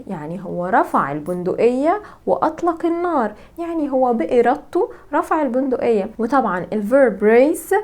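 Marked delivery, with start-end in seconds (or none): none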